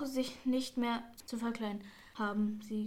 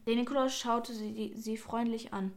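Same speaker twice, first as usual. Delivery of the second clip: usual speaking voice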